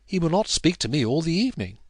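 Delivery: playful wife to guest. A narrator's reading. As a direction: tease about her husband. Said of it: "playfully and in her lightest accent".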